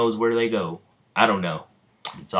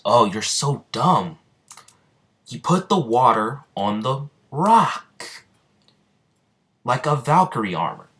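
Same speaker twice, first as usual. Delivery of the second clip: exasperated scolding; 4 semitones higher than usual. Acted. Asta scolds Noel for being dumb, exasperated. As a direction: stretch the words out, pitched up in exasperation.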